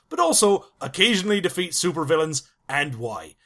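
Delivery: put-on comic British pirate-country accent; southwest England dialect. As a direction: read it in a rough attempt at West Country English. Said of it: "you can have a crack at the local dialect".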